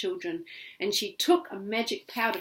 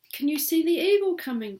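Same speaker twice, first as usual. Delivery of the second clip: put-on child Rose; 8 semitones higher, 4 dB louder, faster than usual.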